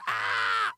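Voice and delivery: imitation baby wail; mock crying scream. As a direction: wail like an infant in the next room. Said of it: "while the baby cry".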